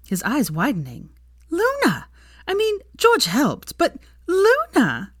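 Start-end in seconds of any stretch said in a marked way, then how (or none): 1.5-5.1 very high, slow, louder gleeful cry; gleeful squeal repeating Luna. A narrator's reading. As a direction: bounce with glee on each Luna. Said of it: "almost bouncing up and down with glee".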